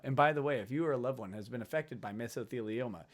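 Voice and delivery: soft, low, fast disclaimer voice; mock advert read. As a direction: rattle it off like a legal commercial. Parodies a legal TV advert.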